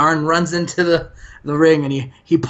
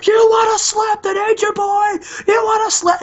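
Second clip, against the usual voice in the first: put-on much higher crazed Nature Boy squeal; Ric Flair. Ric Flair loosening his tie at Alex, high crazed taunt. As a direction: go full Ric Flair madman. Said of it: "he's like giving those crazy Ric Flair eyes".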